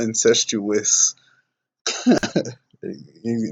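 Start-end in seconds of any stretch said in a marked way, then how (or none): 0.0-1.1 incestuous drawn out slowly; correct pronunciation. Shown as a demonstration. Commentator repeating the right word, very slowly. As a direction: stretch out incestuous, slow and clear. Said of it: "it's incestuous, not incestual".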